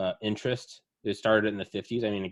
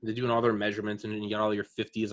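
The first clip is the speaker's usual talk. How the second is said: ordinary talk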